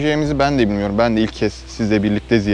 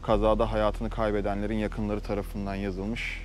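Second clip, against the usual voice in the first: hushed; 10 dB quieter than usual. Real, not acted. Standing at the crash memorial.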